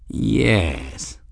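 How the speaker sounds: slow, stretched single word; drawn-out word. A narrator's reading. Lord answering the old man, drawing the word out. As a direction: linger on the word. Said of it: "drawing the word out a bit".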